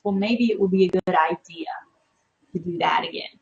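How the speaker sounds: hushed tempting whisper; tempting inner whisper. Retold or imitated. Voicing the mind tempting a relapse, whispered. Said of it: "your mind ever thinks".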